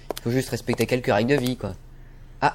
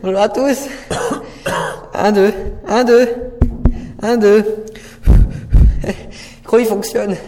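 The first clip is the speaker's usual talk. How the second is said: nervous speech; 5 semitones higher, 4 dB louder than usual. Acted. Nervous speech before a crowd.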